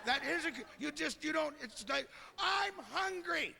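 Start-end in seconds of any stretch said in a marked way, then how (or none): 2.4-3.6 high, drawn-out hollering; bickering pair with identical high voices; couple sounding alike. Acted. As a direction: call out slowly on one high note. Acted couple who sound alike.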